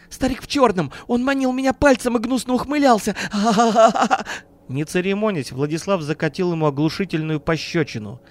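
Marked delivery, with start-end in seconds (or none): 0.1-3.2 high-pitched frantic report; hysterical. A narrator's reading. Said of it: "продолжал биться в истерике Бирюков". 3.3-4.3 high, loud hysterical cackle; hysterical laugh. A narrator's reading. Businessman breaks down at the door sighting, cackling hysterically. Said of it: "продолжал биться в истерике Бирюков".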